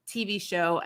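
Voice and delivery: ordinary talk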